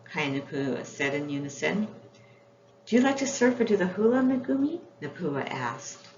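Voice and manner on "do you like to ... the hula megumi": eager question. Acted. Napua eagerly asks new friend.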